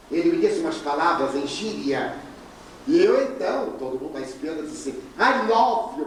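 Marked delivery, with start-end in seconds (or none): 5.2-6.1 called out high in English, quick; showing off English. Shown as a demonstration. Speaker calls to the departing couple, showing off his English. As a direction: toss it off to sound like an English speaker. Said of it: "para a impressão que eu falo inglês".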